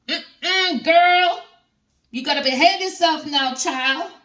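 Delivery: high, loud sassy telling-off, honey; sassy scolding. Acted. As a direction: scold her like a sassy auntie. Sassy scolding of Queen of Coins.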